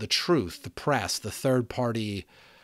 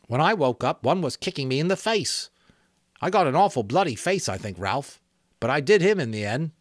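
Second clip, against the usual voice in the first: put-on higher, louder boy's voice, worked up; excited boy recounting; 6 semitones higher, 6 dB louder than usual. Eric telling Ralph how he got kicked, excited boy. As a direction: tell the fight breathlessly, proud you won.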